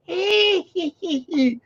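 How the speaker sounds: short high witchy cackle; brief high mocking hag laugh; witch cackle. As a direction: cackle over the cauldron. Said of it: "at the cauldron again".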